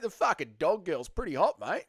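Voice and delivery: imitating Nacho with a light Aussie accent; mock Aussie accent. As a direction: lay on an Aussie "mate" at the end. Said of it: "No, Nacho's an Aussie".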